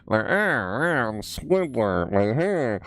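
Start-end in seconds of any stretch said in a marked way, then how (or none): 0.2-2.1 nasal, high cartoon drone, drawn out; goofy nasal cartoon impression; Squidward. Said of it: "you talk up in your nose". 2.4-2.8 slow, high nasal cartoon voice with a honking laugh; nasal cartoon impression ending in a honky laugh; Squidward. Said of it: "that's how Squidward talks".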